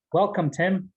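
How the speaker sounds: ordinary talk, nothing marked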